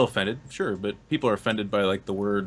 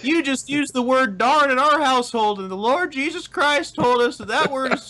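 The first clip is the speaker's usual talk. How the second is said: put-on high, wholesome 1950s kid; gee-whiz sitcom kid voice; Brady Bunch kid; 12 semitones higher than usual. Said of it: "it came off as very, like, Brady Bunch".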